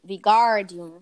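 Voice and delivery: Vigardium with a loud gar; stress on gar. Shown as a demonstration. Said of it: "No gar, no R".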